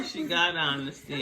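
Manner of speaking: normal talking voice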